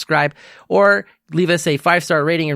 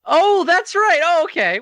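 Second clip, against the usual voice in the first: higher, surprised oh of realization; the penny drops, voice jumps up high; sudden realization; 4 dB louder, slower than usual. Real, not acted. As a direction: catch on all at once and laugh at yourself. Realizing the Twitter name joke.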